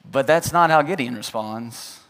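ordinary talk, nothing marked

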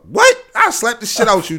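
sudden loud high "What?" then a threat; mock outrage. Friend acting out his reaction to a come-on, loud mock outrage. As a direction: blow up in fake fury.

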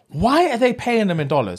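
high-pitched incredulous complaint; retold annoyance. Younger self complaining at films paying in dollars, exasperated disbelief. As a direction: question it as a pet peeve, exasperated. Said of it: "it was such a bugbear".

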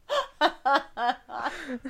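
laughing high and bright; high laughter. Real, not acted. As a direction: break into giggles. Cracks up.

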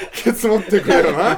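laughing high and loud through the words. Real, not acted. Joking at the friend who said it, laughing loudly. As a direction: laugh while demanding backup. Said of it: "お前が言ったんだから".

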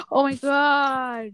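sharp gasp, then a slow, hammed-up "Oh mein Gott"; hammed-up shock. Real, not acted. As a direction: act floored that you share a city. Surprise at same city.

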